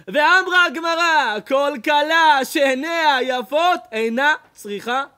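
high, loud and slow, weighing each word; booming preacher citing Gemara; slow loud proclamation. Acted. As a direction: declaim the Talmud like a verdict. Quoting Talmud loudly.

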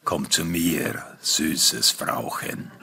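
very low, slow seduction; stranger's luring voice. Acted. Stranger luring the narrator's wife, deep slow voice. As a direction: lure her slowly from deep down.

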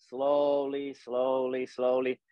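even, stretched-out repetition; slowly drawn out. Shown as a demonstration. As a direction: stretch each word along one slow exhale. Demonstrates slow exhale while drawing line.

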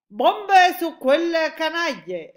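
loud, high, drawn-out war cry; exclamation. A narrator's reading. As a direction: shout it slowly like an order to attack. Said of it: "esclamò il capo dell'aviazione". (grido forte, acuto e allungato)

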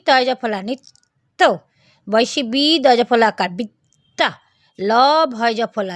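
normal talking voice

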